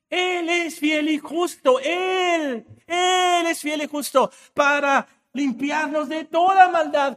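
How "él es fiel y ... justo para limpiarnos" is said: preached shout. Real, not acted. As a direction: declare it as an unshakable truth. Preacher proclaiming to conscience.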